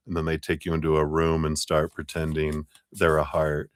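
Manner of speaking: ordinary talk, nothing marked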